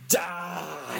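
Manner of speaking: imitating a villain's long wailed word; hammed-up, stretched-out movie doom; drawn-out mock threat. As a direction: drag the vowel right out in mock menace. Acts out Gozer's threat.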